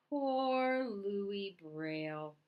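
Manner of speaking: very slow, drawn-out; bitter echo. Acted. Louis echoing the villagers' pity, very slow and bitter. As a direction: drag out the pity mockingly.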